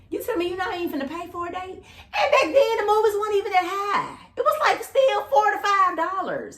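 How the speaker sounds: high-pitched scolding complaint; indignant disbelief. Retold or imitated. Woman scolding a date who won't pay, high and indignant. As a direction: protest in a high, exasperated voice.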